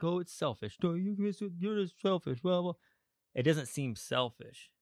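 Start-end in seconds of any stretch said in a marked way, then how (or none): 0.0-2.7 mocking the judgers. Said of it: "that they're like".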